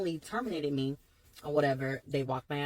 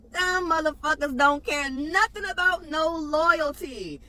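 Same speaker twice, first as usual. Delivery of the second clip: higher, louder, slow venting; indignant outburst; 10 semitones higher, 6 dB louder, slower than usual. Real, not acted. Fired worker rants about Amazon, loud and indignant. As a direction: lay into them, fed up.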